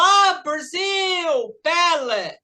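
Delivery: "Ah, Brasil" stretched high, "pele" stressed on PE; gushing drawn-out "pele" with stress up front; imitating foreign mispronunciation. Teacher retells an American meeting a Brazilian, mispronouncing Pelé. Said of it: "a pessoa falou".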